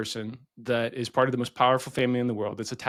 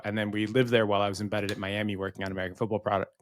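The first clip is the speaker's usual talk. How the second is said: ordinary delivery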